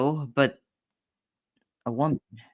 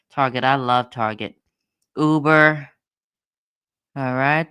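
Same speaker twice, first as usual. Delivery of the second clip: ordinary talk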